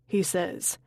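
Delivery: usual speaking voice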